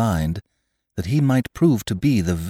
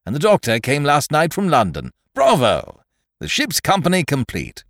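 high, loud jovial squire; excited hearty squire; 6 semitones higher, 3 dB louder than usual. A narrator's reading. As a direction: boom out the good news with glee. Squire greets boy excitedly.

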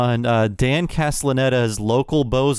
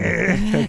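wheezing gasp; 8 semitones higher than usual. Retold or imitated. Mimicking the clown's gasps.

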